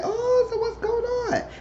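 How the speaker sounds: very high, slow nosy reaction; mock astonishment. Voicing the group's nosy reaction.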